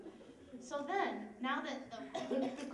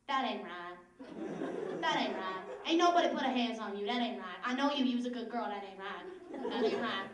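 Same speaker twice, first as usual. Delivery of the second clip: put-on Southern accent; 3 semitones lower, 5 dB louder than usual. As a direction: imitate a concerned local with a Southern accent. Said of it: "I don't know why they had southern accents".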